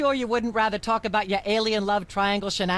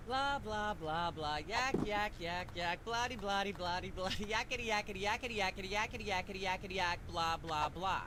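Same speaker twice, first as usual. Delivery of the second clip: much softer droning nonsense chatter; background babble; 14 dB quieter than usual. Acted. Host droning on unheard while guests talk, much softer babble. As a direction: mutter on endlessly under the conversation.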